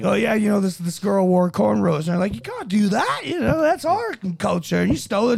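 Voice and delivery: high-pitched outrage; hammed-up protest; mock indignant complaint. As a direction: imitate someone loudly offended. Acting out comedian's offended complainers.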